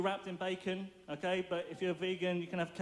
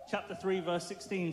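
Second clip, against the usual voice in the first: normal talking voice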